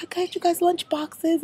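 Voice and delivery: very high mock Australian accent; bad Aussie accent. Retelling host mom's lunch-box welcome, bad Aussie accent. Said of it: "this Aussie accent sucks".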